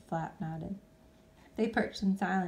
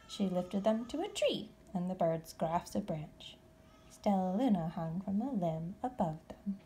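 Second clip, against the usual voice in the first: ordinary delivery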